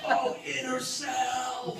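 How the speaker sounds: high, slow drawn-out moan; mock climax moan. A comic mocking Buddhists in bed, high drawn-out moan. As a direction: stretch it out, ecstatic.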